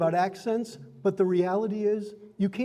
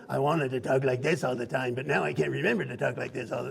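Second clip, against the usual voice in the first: lower-pitched put-on character voice; 5 semitones lower than usual. Shown as a demonstration. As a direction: drop your voice into a made-up character. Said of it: "It's like, I start".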